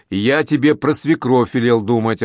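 plain reading voice

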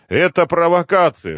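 ordinary delivery, nothing marked

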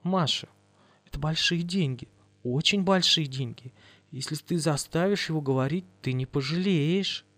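soft, calm persuasion; softly steady reassurance. Acted. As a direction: talk her down quietly. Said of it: "заговорила очень спокойно".

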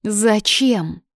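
mildly growled, outraged demand; indignant growl. A narrator's reading. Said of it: "задохнулась от возмущения и прорычала".

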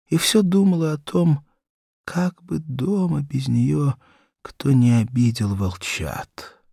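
plain reading voice

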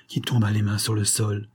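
ordinary delivery

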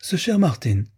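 studied intonation. A narrator's reading. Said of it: "intonations étudiées de sa mère".

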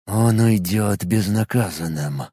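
low, slow words through clenched teeth; through gritted teeth. Acted. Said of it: "процедил сквозь сжатые зубы".

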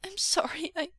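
high, tearful apology; thin, distressed voice; whispered apology. Acted. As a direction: stammer out a sorry and break off. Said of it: "Mo Xuanyu whispered".

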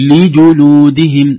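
"لجلودهم" said slowly, i then u clear; careful vowels. Shown as a demonstration. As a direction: keep the kasra and damma apart in "لجلودهم". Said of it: "نلاحظ هذه". ("لجلودهم" بكسر اللام وضم الجيم)

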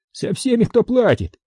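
high-pitched character reply; quartermaster's voice. A narrator's reading. Ответ интенданта, сыгранная реплика. (голос интенданта, высоко)

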